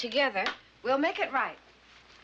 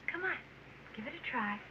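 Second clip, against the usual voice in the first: gentle coaxing; 2 semitones higher, 9 dB quieter, faster than usual. Acted. Teacher coaxing shy pupil.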